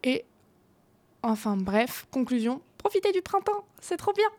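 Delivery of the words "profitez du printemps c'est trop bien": excited. Real, not acted. Upbeat sign-off to listeners.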